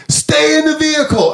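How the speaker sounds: loud, high shouted command; cop screaming. Retold or imitated. Cop screaming at driver in his driveway, loud high yell. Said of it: "the cop is screaming".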